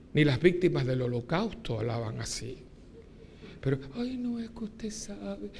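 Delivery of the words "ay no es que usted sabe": high, soft, slow pious mimicry; mock her swooning holy voice; mocking affected churchgoer. Priest imitating a woman claiming God spoke to her. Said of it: "La gente no habla así".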